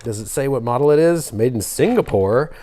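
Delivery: ordinary delivery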